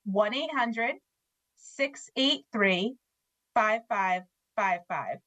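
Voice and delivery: slow, spaced-out digits with pauses between groups; slowly. Shown as a demonstration. Staff guest repeating a phone number for listeners, slowly. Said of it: "Let's say that again slowly".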